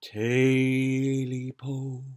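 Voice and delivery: very slow drawn-out call dropping to a whisper; creepy creature cry. Acted. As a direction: moan it like a ghostly creature. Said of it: "the voice cried out".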